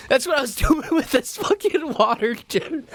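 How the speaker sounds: high-pitched, words breaking into laughter; laughing. Real, not acted. Man recalling his aggressive mopping, laughing through it. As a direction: crack up while telling it.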